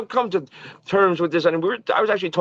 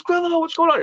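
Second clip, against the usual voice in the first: high, lightly voiced hearty greeting; mock a big friendly hello, pitched up; imitating Don; 13 semitones higher than usual. Agent greeting his old friend at the show, high and cheerful. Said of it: "He goes".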